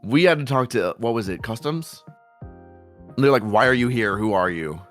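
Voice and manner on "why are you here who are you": normal talking voice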